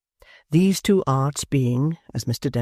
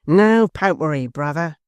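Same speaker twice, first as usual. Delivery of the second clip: loud, higher shout of "No popery"; Hugh's loud reply; 3 semitones higher, 5 dB louder than usual. A narrator's reading. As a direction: throw it back louder. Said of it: "responded Hugh".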